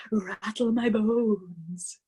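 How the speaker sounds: very slow voiced spooky drawl on Rattle my bones. A narrator's reading. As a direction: stretch Rattle my bones out like a ghost. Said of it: "a wonderful imitation of a spooky voice".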